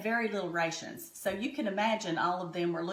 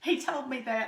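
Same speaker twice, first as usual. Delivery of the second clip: tearing up; 4 semitones higher, 3 dB louder than usual. Real, not acted. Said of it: "I tear up a little bit at this".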